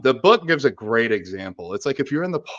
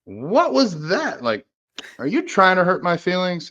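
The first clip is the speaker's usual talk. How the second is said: offended partner; 9 semitones higher, 3 dB louder, slower than usual. Retold or imitated. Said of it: "I'll be like".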